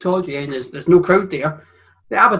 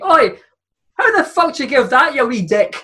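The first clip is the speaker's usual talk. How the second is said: much higher, louder hammed-up English yell; mock English shout; 7 semitones higher, 3 dB louder than usual. Retelling a player yelling at the referee, mock English shout. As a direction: bellow it at the ref like an angry English player. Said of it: "with an English accent, he shouted to the referee".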